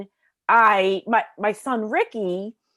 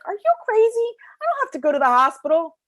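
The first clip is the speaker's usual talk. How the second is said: exasperated; 11 semitones higher, 4 dB louder, faster than usual. Retold or imitated. Arguing with husband about hospital.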